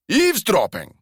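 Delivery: put-on outraged echo of "Eavesdropping!" in a Glasgow accent; indignant disbelief. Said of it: "indignation or incredulity".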